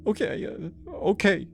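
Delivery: high, faltering defeated plea; broken surrender. Acted. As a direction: give in, broken.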